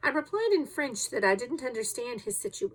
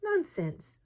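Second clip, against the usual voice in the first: philosophical; 8 semitones higher than usual. A narrator's reading. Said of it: "said Conseil philosophically".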